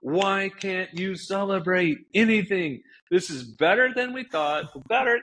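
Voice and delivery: exasperated yelling, reenacted; angry outburst. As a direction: relive the moment you snapped. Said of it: "I just start going off on".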